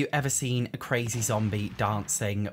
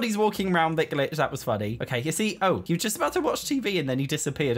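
ordinary talk

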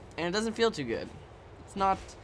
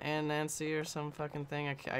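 ordinary talk

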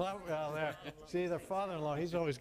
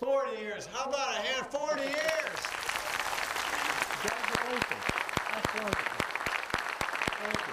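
higher and louder, cheering the couple on; rally the crowd to applaud; booming congratulations to the whole room; excited celebration; 8 semitones higher, 6 dB louder than usual. Real, not acted. Host celebrates forty-year marriage.

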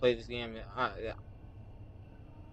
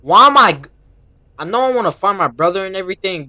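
higher, louder, rushing in panic; panicked; 6 semitones higher, 12 dB louder, faster than usual. Real, not acted. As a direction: gamer panicking about the dangerous road, fast and loud. Panicked gamer reacting to danger.

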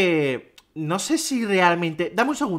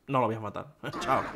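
quiet; 7 semitones lower, 8 dB quieter than usual. Real, not acted. Defeated mutter.

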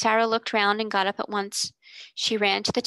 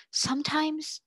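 musing, pitched up and held back; reflective; 7 semitones higher, slower than usual. A narrator's reading. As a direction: think aloud, tentatively.

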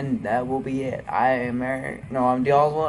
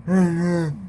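loud, higher, slurred Rocky calling for Adrian; 5 semitones higher, 4 dB louder than usual. Acted. [name]'s Rocky impression calling Adrian, loud and slurred. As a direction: bellow her name like a punch-drunk boxer. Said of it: "My next impression is going to be Rocky".

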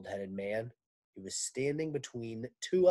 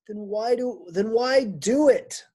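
despairing question; 10 semitones higher, 10 dB louder than usual. Acted. Miss Zarves asking the men, high despairing plea. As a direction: plead why bother at all.

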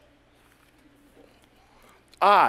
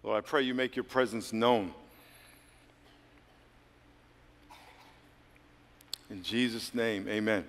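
much softer, low closing prayer; hushed prayer; 7 semitones lower, 9 dB quieter than usual. Acted. Pastor closing the prayer over bowed heads, softly. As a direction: pray it quietly, lower, reverent.

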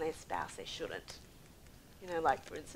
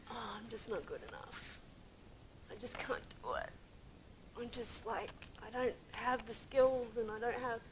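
soft, defeated self-doubt; mock discouragement; 7 dB quieter than usual. Believer lagging behind God, quietly discouraged. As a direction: imitate someone giving up, voice dropped quiet. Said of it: "this fear and this discouragement".

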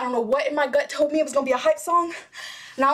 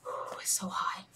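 overheated whisper; 12 dB quieter than usual. Real, not acted. Overwhelmed by the song.

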